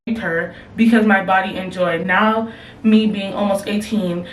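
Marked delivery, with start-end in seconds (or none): none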